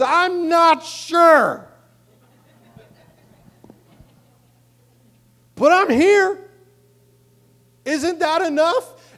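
very high, loud, drawn-out cry; desperate shout. Acted. Acts desperate dad answering Jesus.

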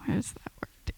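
whispered aside while searching; muttering to self. Real, not acted. Speaker losing their words mid-sermon, whispered to self. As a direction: whisper it under your breath.

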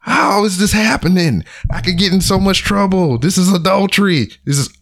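high-pitched mock panic, held up throughout; internal screaming. Screaming inside about getting in trouble, high mock panic. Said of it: "I'm just internally screaming and everything".

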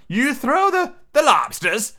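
high-pitched storybook shout. A narrator's reading. Griffin breaking in on the turtle, shouting. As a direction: call it out high, mildly shouted. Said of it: "shouted the griffin".

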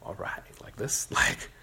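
breathless whisper with a little laugh; awed whisper. Pretend. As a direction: whisper it, stunned and giddy. Awed near the stage.

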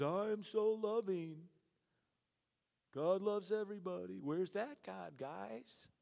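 slow, sneering sing-song mock of God's love; mock the unbeliever's jab at God; mocking sarcasm. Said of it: "with sarcasm added".

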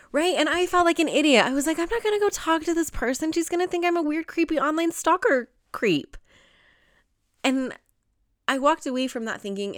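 high-pitched retold panic at herself; embarrassed self-doubt. Speaker retelling fear of seeming a creepy stalker, embarrassed self-doubt. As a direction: voice jumps up, mocking your own worries.

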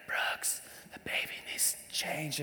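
unvoiced night whisper. Wife waking husband at two in the morning, whispering. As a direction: whisper it so the baby won't hear. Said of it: "Christy's like".

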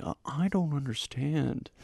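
high, slow, confused voice; Owen Wilson impression. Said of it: "more an Owen Wilson impression you did there".

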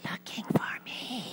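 put-on high, soft child whisper; whispering little boy. Little boy answers a telemarketer's call, whispering. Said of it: "still whispering, the little voice said".

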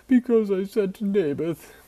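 high, loud whining king; whiny Ahab voice. Acted. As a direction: whine it like a spoiled king. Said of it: "the whiny Ahab voice".

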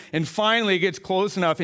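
ordinary talk

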